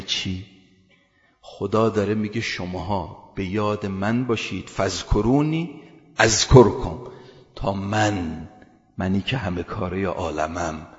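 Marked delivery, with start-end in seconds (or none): 4.7-7.0 loud slow recitation. Acted. Repeating the verse forcefully.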